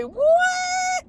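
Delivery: startled cry at the radio; shocked shout. Retold or imitated. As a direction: yell it in total shock.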